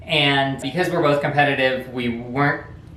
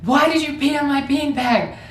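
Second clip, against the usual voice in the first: much higher, accusing big brother voice; older brother confronting little brother over the beanbag, outraged; mock outraged brother; 11 semitones higher than usual. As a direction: demand an answer, outraged. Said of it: "he goes".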